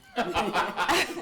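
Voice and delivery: high, loud real laugh; loud burst of laughter. Real, not acted. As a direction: let a big laugh burst out.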